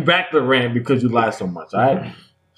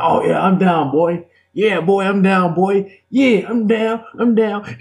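higher and higher, hyped-up agreeing; mock eager friend; 8 semitones higher, slower than usual. Host mocking Joe's eager yes, rising higher. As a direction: imitate an overeager buddy who always says yes. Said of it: "You were like".

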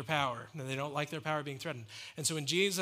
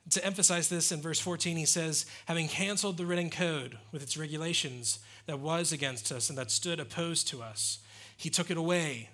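full-force preaching; 3 semitones higher, 4 dB louder than usual. Acted. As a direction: preach it out with fire to the congregation.

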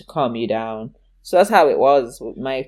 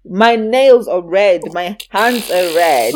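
slow, louder, deliberate mum pointing out the evidence; slow emphatic mum voice, louder; stern mum; 4 semitones higher, 6 dB louder, slower than usual. Retold or imitated. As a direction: spell out the obvious like a mother who isn't fooled. Mum confronting lying child.

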